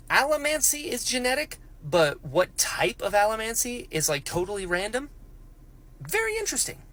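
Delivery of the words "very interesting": exaggerated sing-song intrigue; mock intrigued. Real, not acted. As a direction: play up the intrigue in a squeaky tone.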